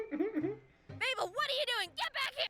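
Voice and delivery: high-pitched mock yell across a lake; frantic mom calling her daughter; yelling lady. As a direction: call for a lost kid. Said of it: "some lady was yelling".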